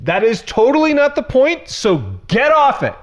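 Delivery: climbing onto a soapbox and not getting off; ranting. Real, not acted. Pastor venting at Christians who miss the point, worked-up rant. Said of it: "All right, I'm off it".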